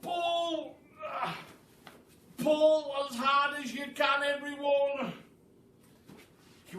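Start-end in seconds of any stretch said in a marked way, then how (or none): 0.0-5.1 high, loud yell; yelled order. Acted. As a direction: yell the single word pull. Mugglewump yelling at all.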